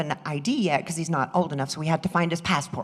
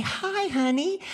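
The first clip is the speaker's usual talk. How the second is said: sweet greeting; 11 semitones higher, slower than usual. Retold or imitated. Greeting the sweet young boy.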